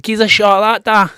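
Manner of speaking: loud, high Scottish kid impression; imitated Scottish accent. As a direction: mimic your child's broad Scottish accent. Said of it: "they've all got Scottish accents".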